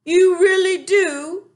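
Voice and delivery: slow, very high and loud; delighted disbelief. A narrator's reading. Giant can't believe Sophie likes his talk. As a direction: savour the happy surprise.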